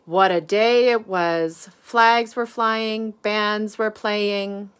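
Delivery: lively storybook voice, big swings up and down; animated read-aloud for a class; reading to children. A narrator's reading. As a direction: read it out like bedtime story time for little ones. Reads picture book to children.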